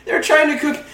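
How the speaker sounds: high-pitched victim voice; frightened escapee. Acted. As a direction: say it high, as the captive. Victim voice, then own character.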